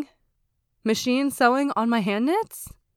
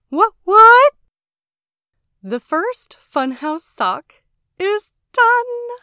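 high, drawn-out, overjoyed announcement; excited celebration; 8 semitones higher, 8 dB louder, slower than usual. Real, not acted. Podcaster announcing her finished sock to listeners, excited. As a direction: crow it out, high and loud, stretching words.

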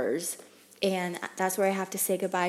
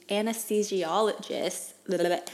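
normal talking voice

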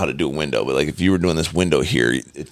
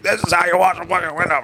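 psychopath boss; 11 semitones higher than usual. Retold or imitated. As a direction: bark it like a crazed boss showing the right way. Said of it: "Like a psychopath".

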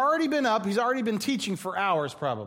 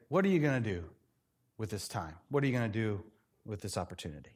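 much lower and softer, yielding; humble; 9 semitones lower, 6 dB quieter, faster than usual. Retold or imitated. Believer quietly asking God what he will do, low and soft. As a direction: offer it gently, surrendering.

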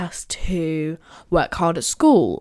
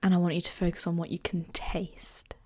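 guided meditation. Acted. Leads a short guided meditation.

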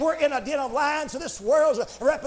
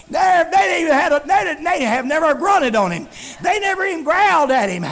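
fervent shouted preaching; full-force preaching; 4 semitones higher, 6 dB louder than usual. Real, not acted. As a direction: drive every phrase home to the congregation.

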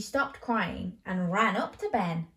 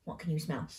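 ordinary delivery, nothing marked

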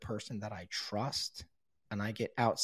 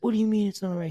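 high, loud incredulous retort; outraged disbelief, pitched up; indignant protest; 9 semitones higher, 8 dB louder, much faster than usual. Retold or imitated. Protesting to the sponsor, high and indignant.

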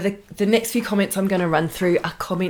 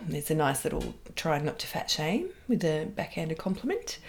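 ordinary talk, nothing marked

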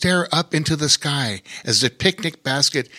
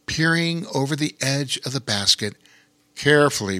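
ordinary delivery, nothing marked

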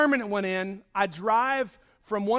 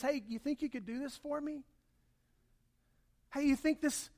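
offhand mumbled request, much softer; casual ask; 4 semitones higher, 8 dB quieter than usual. Retold or imitated. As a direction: toss it off like a small favour. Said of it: "not something that someone casually just says".

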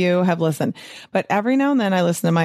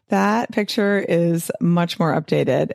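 ordinary delivery

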